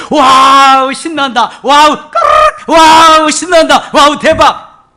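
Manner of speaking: loud, high squealing wow with a giggle; preacher demonstrating childlike joy to the congregation; childlike glee. Shown as a demonstration. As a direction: cheer like a delighted child. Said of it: "이거 못하잖아".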